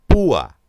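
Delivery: "Púa" with a loud punch on "Pú"; "Púa" as "PÚ-a", strongly stressed; stressed closed vowel. Shown as a demonstration. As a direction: hit the "ú" of "Púa" hard, then a separate "a". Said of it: "la cerrada es tónica".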